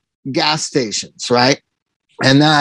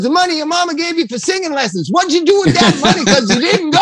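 much higher, fast, laughing clerk voice; store clerk; 11 semitones higher, faster than usual. Retold or imitated. Clerk teases the singer about skipped singing lessons, high and laughing. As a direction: crack up while scolding about the singing lessons. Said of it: "And he goes".

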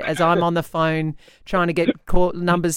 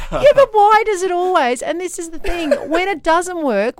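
higher, laughing complaint; laughing exasperation; 10 semitones higher, 5 dB louder than usual. Real, not acted. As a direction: laugh it out, fed up.

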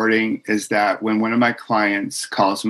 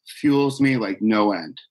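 ordinary talk, nothing marked

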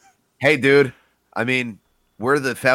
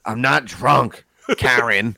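put-on slow, slurred drunk uncle; slower than usual. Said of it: "sound like my Uncle Richie".

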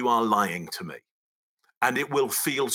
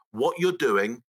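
ordinary talk

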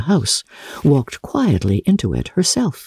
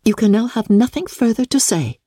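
resentful; 9 semitones higher than usual. A narrator's reading. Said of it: "Elizabeth resentfully answered".

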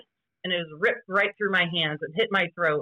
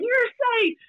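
retold yell. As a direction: shout the call across the field. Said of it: "everybody's yelling".

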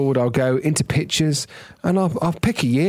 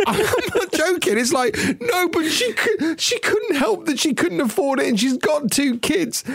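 laughing high voice. Real, not acted. Laughing, half-teary about TV show.

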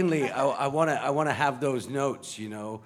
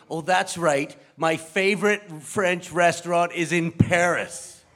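smug show-off; 6 semitones higher, 7 dB louder than usual. Acted. Bachelor corrects himself, show-off.

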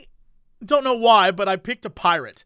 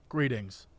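deadpan greeting; 9 semitones lower, 12 dB quieter than usual. Retold or imitated. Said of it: "address people with".

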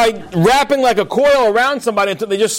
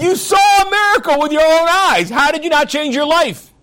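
indignant rebuke; 7 semitones higher than usual. Retold or imitated. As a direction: press him to face the miracle. Rabbi rebukes the cab driver.

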